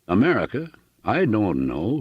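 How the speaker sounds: put-on earnest heavy German accent; a homely German farmer, sincere and accented. Hooven explains his fatherland to Annixter, German accent.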